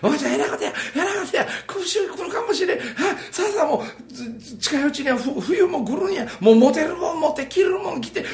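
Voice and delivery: put-on a frightened boy rushing his mother; panicked child voice. 子供時代の母とのやりとり. (母を急かす子供、高く慌てた声)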